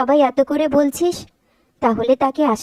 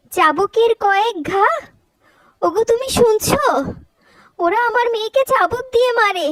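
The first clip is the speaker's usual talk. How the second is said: shocked disbelief; 8 semitones higher than usual. Acted. Mother hears her daughter is whipped, high and aghast. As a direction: reel at the news, call to your husband.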